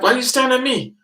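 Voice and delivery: high, loud indignant question; indignant squeak. Retold or imitated. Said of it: "I'm like".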